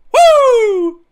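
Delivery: high, loud whoop. Real, not acted. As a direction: let out a delighted whoop. Celebrating third goal.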